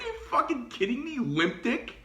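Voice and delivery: high, fast incredulous jab; incredulous scorn. Retold or imitated. Mocking the tactical guy, high and incredulous. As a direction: spit it out in disbelief. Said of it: "I'm like".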